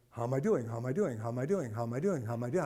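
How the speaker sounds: low, soft, stunned repeating to himself; dazed muttering. Retold or imitated. Patient dazed by a doctor's question, repeating it low and soft. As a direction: mumble it over and over, dumbfounded. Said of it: "And I'm like".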